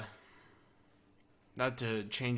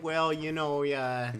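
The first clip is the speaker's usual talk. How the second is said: raised, hedging Canadian guard; Canadian accent; 4 semitones higher, 5 dB louder, slower than usual. Acted. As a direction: lightly put on a Canadian guard. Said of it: "The Canadian, uh, security guard".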